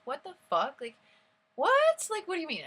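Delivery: very high, loud incredulous outburst; shrieked-up disbelief, acted out; shocked disbelief. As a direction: re-enact stunned outrage, voice leaping up. Retold shocked reaction to the news.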